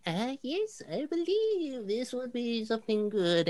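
put-on high, quavering old lady voice; very old woman. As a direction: play a frail shopkeeper granny, high-pitched. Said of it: "very old lady".

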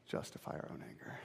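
hushed whispered repeat. Real, not acted. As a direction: whisper it to yourself.

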